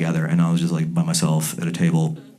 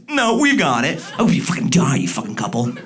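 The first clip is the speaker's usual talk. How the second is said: mock angry retort; 6 semitones higher than usual.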